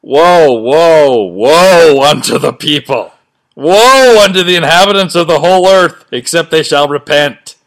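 loud, slow booming prophet voice; mock biblical prophet. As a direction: boom it out like an angry god. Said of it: "So this is Christ talking".

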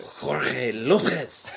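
slow, laughing mock-Spanish Jorge Lopez; mock Spanish accent. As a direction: ham up a news anchor's Spanish accent. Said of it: "they try to give it the accent".

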